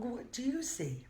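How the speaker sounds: ordinary delivery, nothing marked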